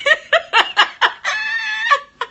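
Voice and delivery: a real, high, loud peal of laughter; high burst of laughter. Real, not acted. Laughing at her old mix-up with the teacher, a high loud laugh.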